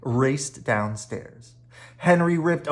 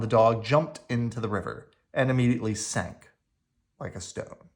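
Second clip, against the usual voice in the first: ordinary delivery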